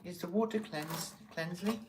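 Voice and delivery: high, mock English accent; a shaky attempt at English accent. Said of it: "I can't do an English accent".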